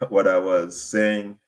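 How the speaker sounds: ordinary talk, nothing marked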